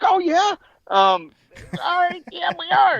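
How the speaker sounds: high, surprised agreement; mock surprise. Told by the owner they are kin, high mock-surprised voice. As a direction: squeak out a startled yes. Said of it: "I was like".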